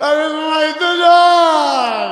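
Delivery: very high, loud, long-held wail; anguished preaching cry. Acted. Preacher voicing Umar who cannot sleep, anguished cry. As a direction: belt it out, stretching the last syllables.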